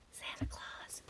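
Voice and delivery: breathy aside whispered. Acted. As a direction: whisper the name like a secret. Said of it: "Has to be very quiet".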